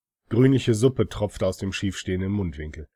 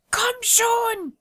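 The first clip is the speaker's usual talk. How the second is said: put-on high, urgent old woman call. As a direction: call out over the shoulder like a crone. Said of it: "rief sie mir".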